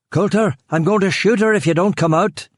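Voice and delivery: high, shouted threat; yelled. A narrator's reading. Killian threatening the hiding man, high shout. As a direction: yell it out across the garden. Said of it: "he yelled".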